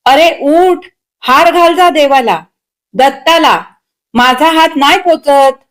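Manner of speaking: scolding yell. A narrator's reading. Mother sending her son to garland the god, yelling. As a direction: order him up impatiently. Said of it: "त्या राहुलवर ओरडून म्हणाल्या".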